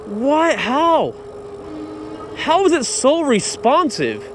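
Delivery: high, stunned questions; amazed disbelief. Real, not acted. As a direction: sound truly floored. Amazed by throttle response.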